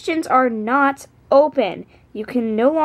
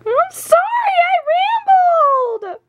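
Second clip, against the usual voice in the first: very high, drawn-out mock wail; hammed-up high-pitched whining apology; slower than usual. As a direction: squeal the apology, stretching the last word. Wailed apology for dead battery.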